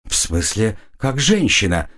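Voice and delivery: usual speaking voice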